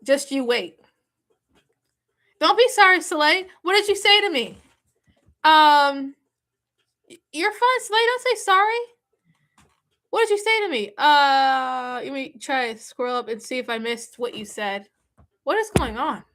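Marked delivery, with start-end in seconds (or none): 2.4-10.9 excited. Real, not acted. Excited reply to a viewer.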